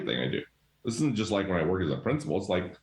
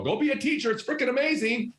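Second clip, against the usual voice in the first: much higher, hammed-up gushing teacher; mock enthusiasm; 13 semitones higher than usual. Principal mocks a leaving teacher gushing about the job, hammed-up high. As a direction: squeal it like an overeager recruiter, tongue in cheek. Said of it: "They're not gonna leave their profession and go".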